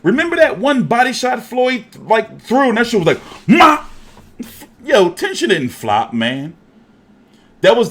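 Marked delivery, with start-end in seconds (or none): 3.5-3.9 high, loud mock yelp of pain; sudden yelped cry; imitated pained grunt. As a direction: blurt a hurt yelp. Imitating body shot reaction.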